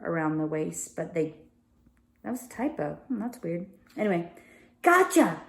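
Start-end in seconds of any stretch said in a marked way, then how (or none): 4.8-5.4 high, triumphant mock snarl as the villain Crowley. A narrator's reading. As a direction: pounce on her with a gleeful gotcha. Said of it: "snarled Crowley in triumph".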